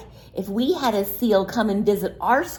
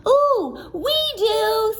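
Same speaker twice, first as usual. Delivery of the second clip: put-on eager children; 13 semitones higher, slower than usual. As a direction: cheer like excited children. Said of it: "said the children".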